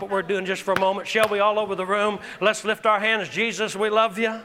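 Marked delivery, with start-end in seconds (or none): none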